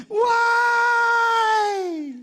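high, loud wail of why; hammed-up howl of dismay; anguished mock shout. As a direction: cry out at the sky in despair. Reacting to the crash.

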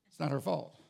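normal talking voice

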